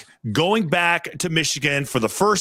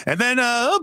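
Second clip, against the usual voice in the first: ordinary delivery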